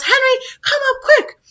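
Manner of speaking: high, loud call for her husband; mother yelling. Retold or imitated. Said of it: "and she yells".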